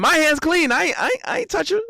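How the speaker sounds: high, hurried playful denial; mock innocence. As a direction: protest innocence, about to crack up. Gleefully acts out denying involvement.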